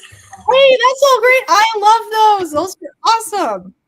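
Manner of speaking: high, loud and bright hello to a friend joining; excited greeting. Real, not acted. Greeting Jen arriving on stream.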